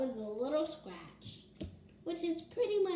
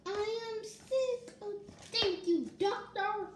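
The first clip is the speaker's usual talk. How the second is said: 1 character voice; 7 semitones higher than usual. Acted. Child voices sick character.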